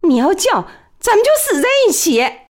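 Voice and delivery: high, intense threat; high menacing threat. A narrator's reading. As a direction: threaten him, voice rising. Threat with knife.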